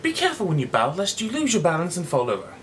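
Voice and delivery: fast mock-careful warning; hurried considerate. Cyrano warning the heckler, fast and mock-considerate. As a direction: rush the polite caution.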